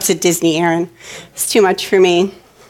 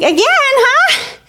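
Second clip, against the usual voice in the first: high, slow, drawn-out hesitant reply; unconvinced, playing along; feigned enthusiasm; much slower than usual. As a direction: stall in a high kid voice. Feigned enthusiasm hiding reluctance.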